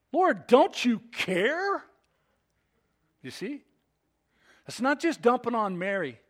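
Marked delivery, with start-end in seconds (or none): 0.1-1.8 high, loud, drawn-out complaint; acted indignant appeal, stretched out slowly; bothered protest. Preacher playing Martha bothered with Jesus, loud indignant protest. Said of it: "the bother extends to him as well".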